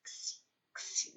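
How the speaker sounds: "x, x" as a whispered "ksi"; isolated syllable sound. Shown as a demonstration. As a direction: sound out "x, x" softly as "ksi", voiceless, with a gap between. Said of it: "a sílaba forte é o".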